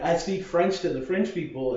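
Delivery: ordinary delivery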